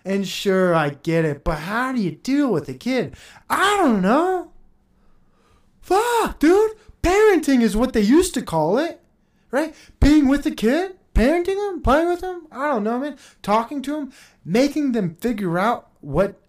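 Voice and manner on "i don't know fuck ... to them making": exasperated rant. Acted. Mocking exasperated parents.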